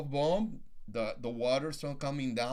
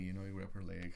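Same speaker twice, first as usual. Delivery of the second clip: ordinary talk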